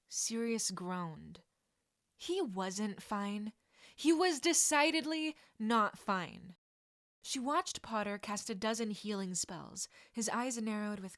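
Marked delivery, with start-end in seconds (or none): none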